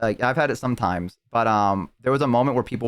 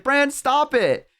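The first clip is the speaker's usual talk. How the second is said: much higher, slow, drawn-out plea; 14 semitones higher, much slower than usual. Retold or imitated. Tiefling pleading with the climbing rogue, high slow plea. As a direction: stretch it out, begging him to stop. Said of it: "And she's like".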